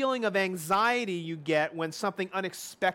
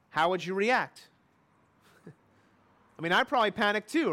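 usual speaking voice

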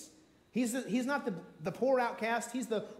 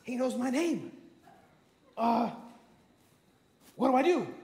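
acted panic, breaking out louder at the end; flustered Zacchaeus; 3 semitones higher, 3 dB louder than usual. Acting Zacchaeus spotted in the tree.

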